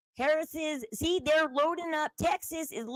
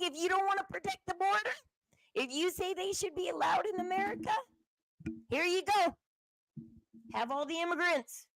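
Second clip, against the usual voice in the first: higher, softer mocking taunt with a laugh in it; sarcastic gift to Kamala, pitched up and soft; 5 semitones higher, 5 dB quieter than usual. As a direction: dump them on her with a sneer.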